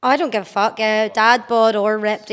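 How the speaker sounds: ordinary talk, nothing marked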